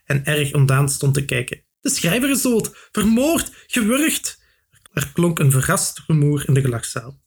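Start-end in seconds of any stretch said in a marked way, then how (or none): 1.8-4.4 much higher, breathless horror; panicked panting. A narrator's reading. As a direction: gasp out the terrible news. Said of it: "hijgde de jongeman".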